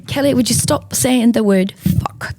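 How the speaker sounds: a hint of a weary mother; lightly voiced exasperated rebuke; Sharon's scolding. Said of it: "Just the way she said it was so hilarious".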